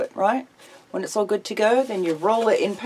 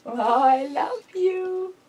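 "I love you" drawn out very slowly, high, leaning on "love"; slow drawn-out model; 7 semitones higher, much slower than usual. Shown as a demonstration. Owner teaching her pet bird the phrase, slow sing-song drawl. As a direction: stretch every word so the bird can copy it. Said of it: "Tell me".

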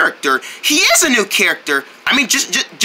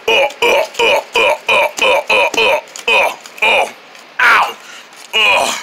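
grunts of impact; slower than usual. Acted. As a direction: run of short cartoon grunts. Fighter tumbles after hit.

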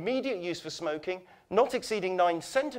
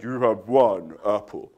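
low, slow stern judge; deep measured judge's answer; 9 semitones lower, 5 dB louder, slower than usual. Retold or imitated. Judge answering him about the apple, low and slow. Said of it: "And the judge said".

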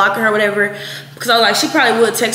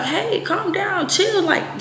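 soft, high, slow calming voice; drawn-out, softened plea to relax; soothing; 9 semitones higher, 6 dB quieter, much slower than usual. Retold or imitated. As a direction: imitate someone gently talking her down. Retells a dude soothing her.

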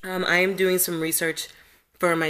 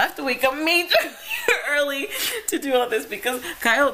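high laughing, crying words; being tickled; 7 semitones higher than usual. Real, not acted. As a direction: giggle through it while tickled. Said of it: "Kyle will not stop tickling me".

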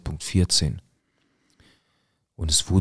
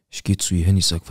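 usual speaking voice

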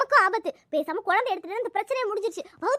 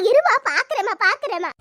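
ordinary talk, nothing marked

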